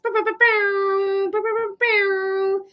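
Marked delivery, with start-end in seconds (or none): none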